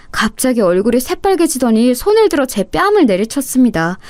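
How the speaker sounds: plain reading voice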